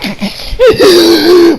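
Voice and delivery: high cracking laugh burst; wheezing laugh. Real, not acted. Reviewer cracking up at Gohan, high wheezing laugh. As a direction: break up laughing.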